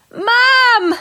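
high, loud yell for help. A narrator's reading. Girl yelling for Mum after friend's finger is hurt, loud and high. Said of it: "yelled Lucy".

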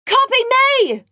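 excited. Acted. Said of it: "said Anna".

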